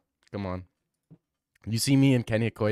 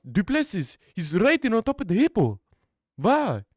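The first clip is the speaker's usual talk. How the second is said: lightly put-on panicky South African; a bit louder, frantic about hippos; panicked South African; 2 semitones higher, 3 dB louder than usual. South African fleeing hippos, lightly voiced panic.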